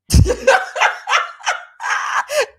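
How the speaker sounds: loud wheezing cackle. Real, not acted. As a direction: break into a loud cackling laugh. Cracking up at a quote.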